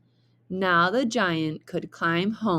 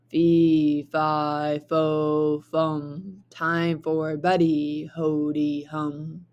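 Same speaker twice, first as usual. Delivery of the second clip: booming giant; 2 semitones lower, slower than usual. Acted. Giant sings out from inside the castle, low and booming. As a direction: boom it out like a sleepy giant.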